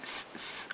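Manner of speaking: soft unvoiced hissing; imitated snake hiss. Owner imitating her snake's little hiss, soft and unvoiced. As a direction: hiss softly like the snake did. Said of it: "She went".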